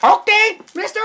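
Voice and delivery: very high, loud conehead alien. Pretend. Calling to Tim in game chat, conehead voice. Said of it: "I sound like a conehead".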